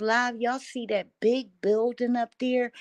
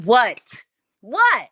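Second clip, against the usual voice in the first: loud startled what; 10 dB louder than usual. Retold or imitated. Shocked at the calls.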